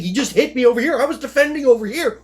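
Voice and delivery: loud, high, climbing excited recreation; astonished outburst. Retold or imitated. Fighter finally seeing how he got hit, astonished. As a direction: burst out as the puzzle suddenly solves. Acts out realization about the lefty.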